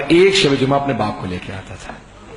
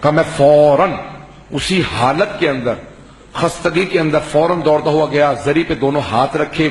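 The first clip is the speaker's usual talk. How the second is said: ordinary talk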